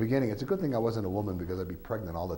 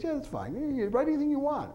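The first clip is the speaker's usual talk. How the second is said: put-on much higher, quick and breezy old-friend voice; imitating Rollo. Rollo telling the author to write anything, high and quick. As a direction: toss off the permission lightly.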